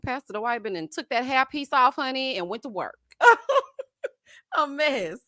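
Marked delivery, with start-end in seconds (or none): 3.2-3.8 high, loud burst of laughter. Real, not acted. Host cracking up over Pastor Dwight, high loud cackle. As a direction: cackle high at your own joke.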